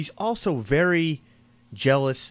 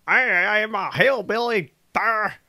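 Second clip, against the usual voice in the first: higher-pitched, badly faked hillbilly accent; failed hillbilly impression; 7 semitones higher than usual. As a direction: play a hammed-up hillbilly, not very well. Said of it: "I can't even be a hillbilly if I tried".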